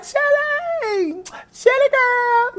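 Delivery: very high, drawn-out squeal; fan calling out. Retold or imitated. Fan trying to get singer's attention at autographs, high squealed call. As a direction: imitate a fan's falsetto holler.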